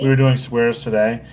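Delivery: light attempt at Australian; put-on Australian twang; mimicked Australian accent. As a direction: echo her in a rough Australian accent. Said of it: "how much I try to mimic her accent".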